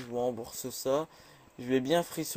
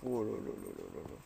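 much lower, softer oh là là; low dismayed groan; 13 semitones lower, 8 dB quieter than usual. Real, not acted. Groaning at the approaching spider.

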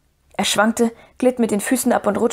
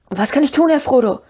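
calling out; 5 semitones higher than usual. A narrator's reading. Sam leans out over the edge to Frodo, calling out. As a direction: call down to your master. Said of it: "rief Sam".

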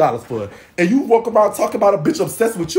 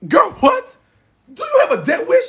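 much higher, slow incredulous squeal; outraged disbelief; 12 semitones higher than usual. Real, not acted. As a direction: stretch it out in shock.

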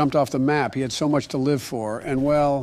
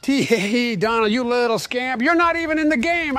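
higher tee-hee teasing; giggly tease; 7 semitones higher, 4 dB louder than usual. Acted. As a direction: giggle at Donald like a scamp. Joe teasing Donald giggly.

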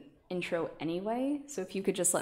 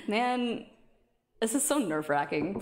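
ordinary talk

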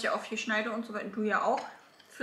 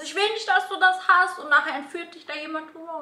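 mom voice; 6 semitones higher, 6 dB louder than usual. Retold or imitated. Mother's outraged quote retold.

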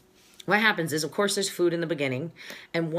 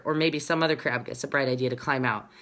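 ordinary delivery

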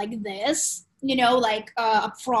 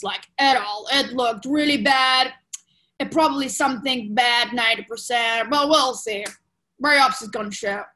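blunt doctor; 6 dB louder than usual. Retold or imitated. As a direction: rattle off bad news like a cold surgeon. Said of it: "That's how he talks. Very, da, da".

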